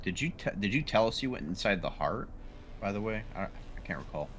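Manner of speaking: ordinary talk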